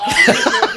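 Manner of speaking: high unvoiced laugh; airy burst of laughter; breathy laugh. Real, not acted. Laugh after story.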